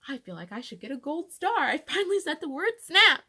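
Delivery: loud, high little victory cry; triumphant. Retold or imitated. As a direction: celebrate like you earned a gold star. Triumph after the stuck word.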